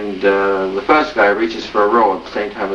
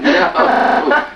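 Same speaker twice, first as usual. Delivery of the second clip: laughing. Real, not acted. Teller laughing mid-punchline.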